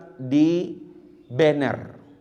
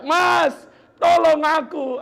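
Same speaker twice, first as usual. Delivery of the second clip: high, loud panicked call to her husband; desperate cry for help; 5 dB louder, slower than usual. Retold or imitated. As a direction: scream for him in tears. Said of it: "Dia teriak".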